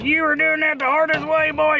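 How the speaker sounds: high, mocking know-it-all voice; know-it-all electrician. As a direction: needle him like a smug old pro. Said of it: "are probably going".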